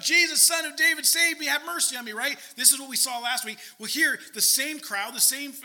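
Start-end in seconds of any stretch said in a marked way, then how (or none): 0.0-2.1 loud, high pleading shout; imitated scream. Blind beggar calling to Jesus passing by, imitated desperate shout. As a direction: cry out for mercy over a crowd, high and loud. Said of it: "he screams out".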